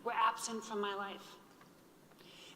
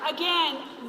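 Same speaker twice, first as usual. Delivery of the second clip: ordinary talk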